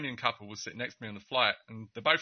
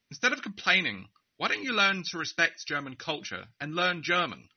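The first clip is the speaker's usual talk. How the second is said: proud loud rebuke; 6 semitones higher than usual. Retold or imitated. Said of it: "proudly, and at a volume".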